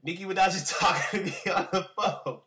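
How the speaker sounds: high, loud, laughing exclamation; retold amazed laughing outburst; laughing disbelief. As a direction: blurt it out laughing in shock. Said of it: "I was like".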